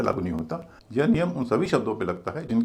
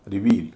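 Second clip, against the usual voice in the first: "Reveal" with a light "रि" and heavy "वील"; stress on second syllable; 3 semitones lower, 4 dB louder, slower than usual. Shown as a demonstration. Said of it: "दूसरा भारी है". ("Reveal" में "वील" भारी, "रि" हल्का)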